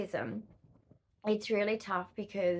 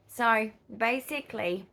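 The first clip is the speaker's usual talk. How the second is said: ordinary talk, nothing marked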